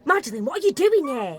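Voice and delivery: startled exclamation. Acted. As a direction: cry out at an unexpected sight. Startled at finding Madeline.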